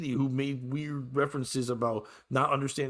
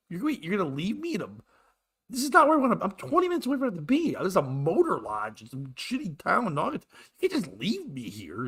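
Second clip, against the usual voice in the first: higher-pitched, incredulous complaint; indignant protest; 8 semitones higher than usual. Retold or imitated. Passenger to driver who is abandoning him, indignant. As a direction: protest in rising disbelief.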